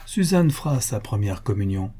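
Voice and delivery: ordinary delivery, nothing marked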